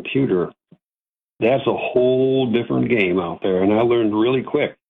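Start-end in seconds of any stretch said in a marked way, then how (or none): none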